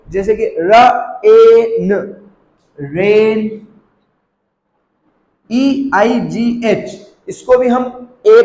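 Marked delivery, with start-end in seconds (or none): 0.6-3.7 र, ए, न as separate sounds, then a drawn-out रेन; sounded out slowly. Shown as a demonstration. Teacher showing learners the long ए, sounded out slowly. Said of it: "ए pronounce करेंगे".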